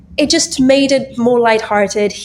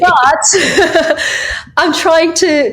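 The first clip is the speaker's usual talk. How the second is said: laughing; 8 semitones higher, 3 dB louder, much slower than usual. Real, not acted. Laughing at her own chaos.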